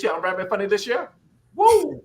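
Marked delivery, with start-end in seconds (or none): 1.6-2.0 high excited woo; sudden high, loud whoop. Real, not acted. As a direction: let out a joyful whoop. Whoops after rapping joke.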